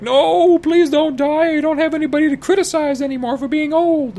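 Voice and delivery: a hammed-up shrieking damsel; wailing Leia. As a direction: beg him to stay, hammed up. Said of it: "Leia's like".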